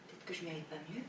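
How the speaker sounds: very soft, resigned admission; quietly defeated; resigned self-blame. Acted. Woman blames herself for bad luck.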